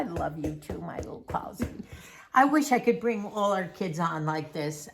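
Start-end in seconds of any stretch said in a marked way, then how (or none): 0.0-1.7 soft whispered endearment; real whisper to her daughter; tender whisper. Real, not acted. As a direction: breathe it as a loving aside. Mother tenderly addressing daughter.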